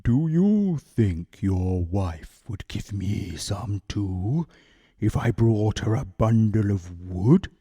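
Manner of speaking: low, soft, slow anxious bear. A narrator's reading. Bear nervously asks the woodman for dal, soft and low. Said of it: "he asked anxiously".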